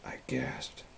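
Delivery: very soft, voiceless gasp; hushed, airy mock gasp; gasped whisper. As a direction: whisper it as if the breath is gone. Acts out the narrated gasp.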